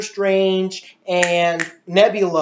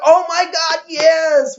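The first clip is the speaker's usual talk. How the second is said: high, loud mock cheer from the audience; imitating cheering crowd; 10 semitones higher, 4 dB louder, slower than usual. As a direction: play the cinema crowd going wild, voice way up. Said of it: "And they're like".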